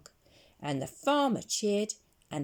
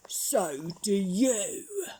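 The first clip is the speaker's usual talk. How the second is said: slow, whiny sulky crook; crook Hugh voice; 3 semitones higher, 3 dB louder, slower than usual. Acted. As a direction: drag it out like a sulky villain. Hugh retorts in story.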